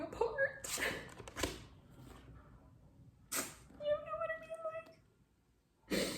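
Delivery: high, slow, soft tearful; choked whimper; crying plea. Real, not acted. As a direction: stretch it out while weeping.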